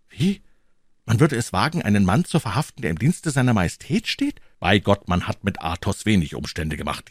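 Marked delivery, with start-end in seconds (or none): 0.1-4.4 high, rising incredulous questioning; young hero scandalized by the idea; young D'Artagnan, outraged. Acted. D'Artagnan doubting anyone would arrest a royal servant, high indignant voice.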